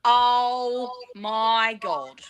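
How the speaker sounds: drawn-out, stunned oh my God; mock disbelief, stretched slowly; shocked gasp. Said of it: "Are you screaming?".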